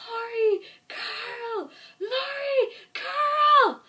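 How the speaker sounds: high, loud calls for lost family; mock desperate yelling. Imitating a man yelling for his wife and son, high mock shouts. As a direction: cry each name out high, hammed-up. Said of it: "starts yelling for his wife and son".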